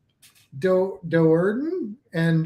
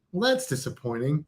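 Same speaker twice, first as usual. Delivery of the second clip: ordinary delivery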